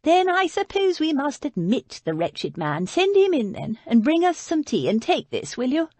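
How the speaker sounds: put-on high, imperious old lady voice; lady of the house giving weary orders; haughty Lady Beatrice. A narrator's reading. Lady Beatrice to her butler, a high, imperious sigh of a command.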